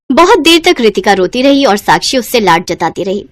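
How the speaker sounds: normal talking voice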